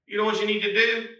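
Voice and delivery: ordinary talk, nothing marked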